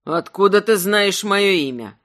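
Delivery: usual speaking voice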